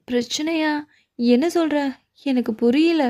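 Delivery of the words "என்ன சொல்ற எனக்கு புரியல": ordinary delivery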